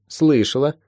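put-on girl's tentative reply. Molly answering her teacher, slightly tentative. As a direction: answer lightly, with a questioning lilt.

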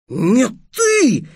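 high-pitched, outraged comeback; indignant retort. A narrator's reading. Boy answering his rival's accusation, indignant and high-pitched. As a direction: throw it back with indignation. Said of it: "возмутился Дерек".

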